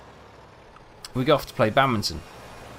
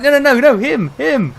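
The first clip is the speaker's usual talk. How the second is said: high, loud frantic denial; mate correcting the confused girl; frantic correction; 10 dB louder than usual. Retold or imitated. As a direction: wave her off in a panic. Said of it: "And it's like".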